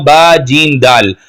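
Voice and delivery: ordinary talk, nothing marked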